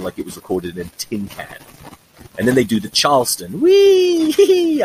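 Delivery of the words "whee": high, loud delighted whee; hammed-up high whoop; mock whoop. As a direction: squeal like dancing the Charleston. Doing the Charleston.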